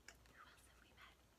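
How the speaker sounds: hushed question; soft whispered quiz. Real, not acted.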